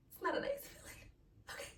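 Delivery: breathy hushed whisper. Real, not acted. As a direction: drop to a whisper to confide it. Confiding hurt, hushed.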